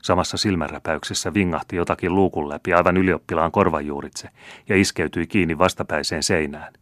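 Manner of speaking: ordinary delivery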